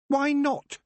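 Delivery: high, loud sharp retort; Tiffany cutting her off sharply. Acted. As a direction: snap the question back. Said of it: "said Tiffany sharply".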